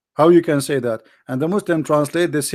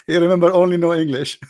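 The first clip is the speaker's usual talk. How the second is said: laughing; 4 semitones higher than usual. Real, not acted. Host laughing that the man only remembers his nickname. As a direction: say it while laughing.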